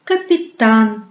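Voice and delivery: "Capitaine" slowly, with the final A drawn out; exaggerated long final A in "Capitaine"; stress on last A. Shown as a demonstration. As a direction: lean on the last syllable of "Capitaine". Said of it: "L'accent tombe sur la dernière A".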